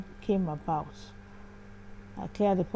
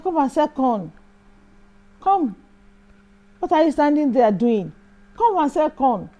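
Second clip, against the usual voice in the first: loud bossy yell, higher; driver shouting; 7 semitones higher, 10 dB louder than usual. Retold or imitated. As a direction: bark orders across the road like a rude driver. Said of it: "he was shouting at".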